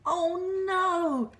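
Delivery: high, loud wail of dismay; Mister Bear despairing. Acted. Mister Bear kept awake by snoring, high loud despair. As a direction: moan it out, fed up. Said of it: "said Mister Bear".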